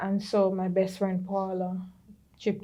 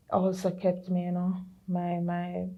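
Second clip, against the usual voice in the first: usual speaking voice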